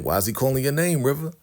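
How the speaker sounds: quick, high, rising demand; acted interrogation; suspicious questioning. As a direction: press for an answer, pitch climbing. Daikon questions River suspiciously.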